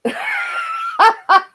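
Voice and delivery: very high squealing laugh; sudden high-pitched giggle; real laugh. Real, not acted. Amused by viewer comment.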